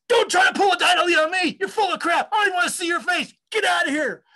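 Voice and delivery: high, loud imitated tirade; acted yelling to throw someone out; angry outburst. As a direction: blow up like a furious old boss. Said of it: "he'd turn and go".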